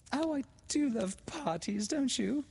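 high, gushing delight; thrilled girlish gasp; excited gasp. A narrator's reading. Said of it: "gasped Laura".

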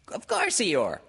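high, loud squeaky voice; piercing little-creature assurance; Piglet. A narrator's reading. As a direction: pipe up eagerly. Piglet reassures Eeyore.

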